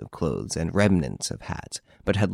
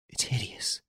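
hideous barely voiced, much softer, breathless; horrified whisper; 2 semitones higher, 10 dB quieter than usual. A narrator's reading. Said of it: "cried Hortense, pale as death".

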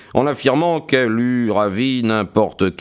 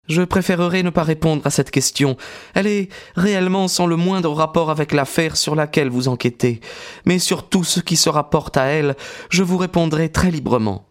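higher, lightly voiced young woman; young woman's voice; 7 semitones higher, faster than usual. A narrator's reading. Maud speaking to Stackhurst, composed young woman's voice.

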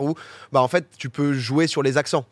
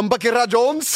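imitated slight Russian accent, pitched higher; 6 semitones higher, 3 dB louder, slower than usual. Host mimicking the AI clone's odd accent, mock Russian accent. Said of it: "il y a un petit truc russe".

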